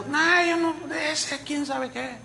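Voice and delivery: high-pitched imitation of brushing someone off; mock dismissive. Emailer refusing a disc on seeing the preacher, mock dismissive.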